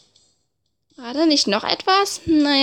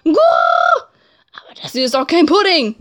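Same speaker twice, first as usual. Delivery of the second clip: shouting pigeon voice; 3 semitones higher, 4 dB louder than usual. Acted. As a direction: bellow like an outraged pigeon. Voices Oko-san shouting.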